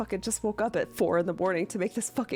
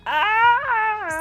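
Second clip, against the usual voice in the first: panicked yelp; 8 dB louder than usual. Retold or imitated. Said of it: "you guys were like".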